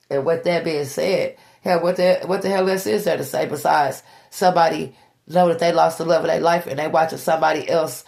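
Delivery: normal talking voice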